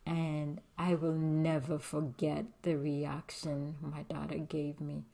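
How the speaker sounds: normal talking voice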